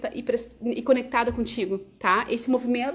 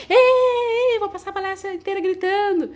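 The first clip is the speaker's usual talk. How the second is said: a loud, high-pitched mock shout of "hey"; shouting demo; 9 semitones higher, 6 dB louder than usual. Shown as a demonstration. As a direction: yell it as a speaker who never stops yelling. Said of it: "ou vou gritar". (grito exagerado e agudo de demonstração)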